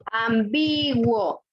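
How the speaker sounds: "Ambiguo" drawn out slowly, leaning on BI; slow, emphatic teacher's "am-BIIII-guo"; stress on the middle syllable. Shown as a demonstration. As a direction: stretch "Ambiguo" out to show the stressed BI. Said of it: "¿Dónde lleva la fuerza de voz".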